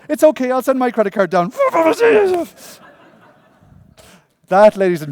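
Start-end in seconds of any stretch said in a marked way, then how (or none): 0.1-1.5 loud, high mock mother, cheerfully naive; imitating mum's breezy reassurance; mother's voice. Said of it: "she goes". 1.6-2.7 big laugh. Real, not acted. Laughing at mum story.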